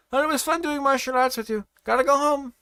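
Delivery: high cheerful voice; bright happy foreigner. A narrator's reading. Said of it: "Pedro said happily".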